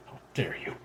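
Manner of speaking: a low, quiet snarl of "How dare you?". Acted. Daughter snarls back at her mother, low and quiet. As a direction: hiss "How dare you?" back under the breath, softly. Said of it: "she snarls".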